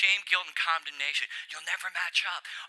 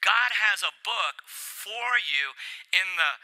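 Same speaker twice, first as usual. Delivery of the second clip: ordinary talk, nothing marked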